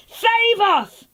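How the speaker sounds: high-pitched plea, no real volume; mild mock shout. God's people calling to Jesus, high mock shout. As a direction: call out high, as if from a crowd. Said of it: "started waving palm branches and shouting".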